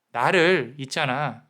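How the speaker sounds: ordinary delivery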